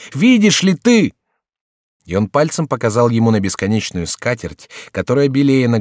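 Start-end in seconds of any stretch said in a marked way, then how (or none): 0.1-1.1 very high, loud, slow question; insistent raised-voice demand; insistent cry. A narrator's reading. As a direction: press the question hard, drawn out. Desperate Henri forces him to look.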